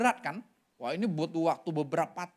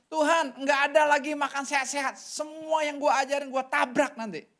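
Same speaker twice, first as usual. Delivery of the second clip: louder, higher mock protest at God; retold anger at God; 7 semitones higher, 4 dB louder than usual. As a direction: fling the complaint upward, half-acting his rage. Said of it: "Dia marah sama Tuhan". (protes marah yang ditirukan, lebih keras)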